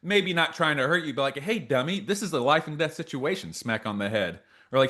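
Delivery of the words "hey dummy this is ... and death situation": higher-pitched mock reprimand; imitated scolding. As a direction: act out correcting a bonehead mistake. Acted scolding before a smack.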